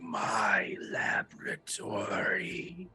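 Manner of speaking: slow whispered swarm of voices; buzzing swarm voice. Acted. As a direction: speak like many insects at once, slowly. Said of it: "like a hundred buzzing voices all at once".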